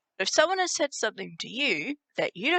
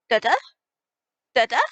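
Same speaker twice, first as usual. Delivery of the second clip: quick clipped shortened version; sped-up form; 3 semitones higher, 7 dB louder, faster than usual. Shown as a demonstration. Teacher showing the fast spoken form of the phrase. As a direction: rattle it off fast. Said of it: "you can speed that up and say".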